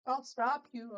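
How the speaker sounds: high, loud mock threat; a squeaky overblown challenge; bandit threat. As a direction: ham up a guard's bluster. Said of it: "and they're like".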